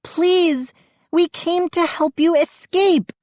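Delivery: high, desperate pleading; high-pitched frantic appeal; screaming girl. A narrator's reading. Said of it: "I heard Mindy scream".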